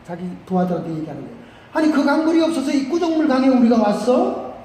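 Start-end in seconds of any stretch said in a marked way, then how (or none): 1.7-4.5 higher-pitched indignant protest; worked-up anger. Acted. Angry general complaining to his men about the muddy river, indignant. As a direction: play an affronted general venting. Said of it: "막 열이 받치고 막".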